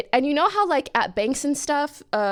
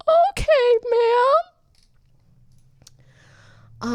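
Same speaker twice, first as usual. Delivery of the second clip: mock compliance; 14 semitones higher, 6 dB louder than usual. Teller voices herself.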